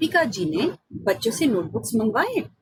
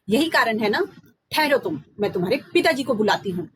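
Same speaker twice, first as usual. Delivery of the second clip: angry mother. Acted. Mother scolds Ram for fake excuses, angry. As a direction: scold the lying child. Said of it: "गुस्से से भरकर आई".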